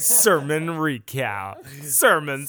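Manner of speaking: very slow, loud announcer boom; radio voice. Pretend. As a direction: stretch it out like a jingle. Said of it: "Jared asked me to get a radio voice".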